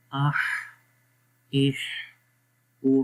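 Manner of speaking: آہ with the mouth open, اح with the jaw down, اوہ with rounded lips. Shown as a demonstration. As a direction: shape each vowel clearly for the learner. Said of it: "زبر آ رہی ہے، منہ کھول کو". (آہ منہ کھول کر، اح منہ نیچے، اوہ ہونٹ گول کر کے)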